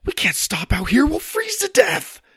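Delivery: high, loud panicked cry; panicking. Acted. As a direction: let the panic climb sharply in pitch. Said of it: "starting to panic".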